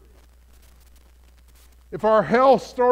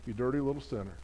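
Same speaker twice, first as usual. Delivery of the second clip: lightly voiced Satan, low and soft; softly sneak in an accusation as the tempter; accusing Satan; 5 semitones lower, 7 dB quieter, faster than usual. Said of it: "what he'll end up saying is".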